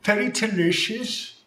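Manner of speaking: mock Aussie accent. Answering a friend's silly Australian bit in kind. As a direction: play along in a squeaky fake Australian accent. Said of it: "is that still Aussie?".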